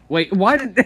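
sudden high excited yelp; excited shout. Real, not acted. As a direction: blurt it out, all excited. Sudden excited outburst mid-game.

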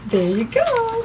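high sing-song praise for a dog. Real, not acted.

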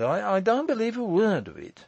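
high, stammering protest; agitated cry. A narrator's reading. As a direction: protest in a fluster, pitch shooting up. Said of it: "he cried".

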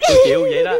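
high, loud wail that could pass for laughter; hammed-up sobbing over the husband; comic crying. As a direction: burst into mock bawling. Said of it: "Mà khóc chứ cười gì".

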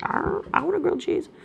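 high, soft voice put on for the dog. Owner voicing her dog begging for grilled cheese, high and soft. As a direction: give the dog a whiny little voice. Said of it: "She was like".

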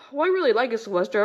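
ordinary talk

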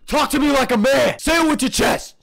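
high, loud shouting; bellowed challenge; forceful demand. Real, not acted. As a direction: yell at a whisperer to speak out. Demands no whispering.

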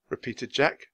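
plain reading voice

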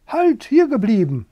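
high, loud command to halt; calling out. A narrator's reading. Hen stops the rooster about to leave, calling out loudly.

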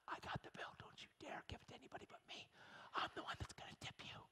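hushed whisper, almost no voice. Retold or imitated. Whispering to the waitress so the pastors won't hear. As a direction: lean in and whisper the secret. Secretly telling waitress he'll pay.

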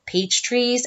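usual speaking voice

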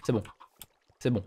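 ordinary talk, nothing marked

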